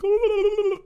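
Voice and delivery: high gasping oh; mock panicked high oh from the sister. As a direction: squeak it out like a frightened girl. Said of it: "And she's like".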